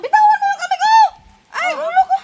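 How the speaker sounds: high, loud panicked yell; shouting. Real, not acted. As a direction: yell at them to let go. Victim yells while hair pulled.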